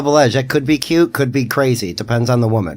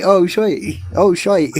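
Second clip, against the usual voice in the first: higher-pitched mock Cockney; Cockney accent; 7 semitones higher, slower than usual. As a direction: exaggerate the Cockney vowel in "shite". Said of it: "Cockney accent".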